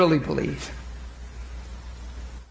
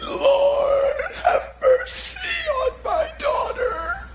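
sobbing plea; slower than usual. Acted. Mother crying at Jesus' feet.

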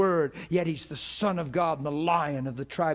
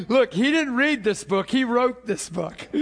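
loud, higher, through real laughter; laughing; 6 semitones higher, 5 dB louder than usual. Real, not acted. Preacher joking that God wrote the book, laughing loudly. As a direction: crack up while making the point.

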